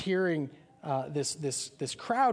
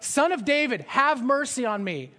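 yelling out; 8 semitones higher, 6 dB louder than usual. Retold or imitated. Beggar keeps shouting to Jesus despite the crowd, loud plea. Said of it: "He won't shut up".

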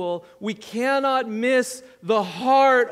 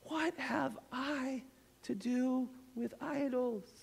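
softer, higher, hurt; mild aching plea; 4 semitones higher, 5 dB quieter than usual. Acted. Preacher voicing the Lord pleading with Ephraim, softer and hurt. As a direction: ask it like a wounded lover. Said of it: "the Lord cries out".

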